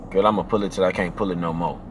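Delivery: low and soft, serious promise; seductive aggression. Shown as a demonstration. Answering her on the phone, deep low seductive promise. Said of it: "You see how I'm talking?".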